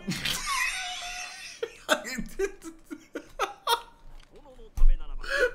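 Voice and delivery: high shrieking laughter, out of control; helpless laughing fit. Real, not acted. Giggling fit while streaming a strategy game, shrieking laughter. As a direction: lose it completely, cackling. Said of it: "suddenly giggling".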